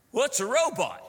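comic incredulous outburst; loud bafflement. Retold or imitated. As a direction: blurt it out, utterly bewildered. Bewildered American reacts.

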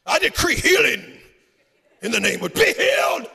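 high, full-volume mock faith-healer shout; hammed-up revival-tent proclamation. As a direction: imitate a preacher bellowing a healing decree. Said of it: "that's what I think preachers sound like".

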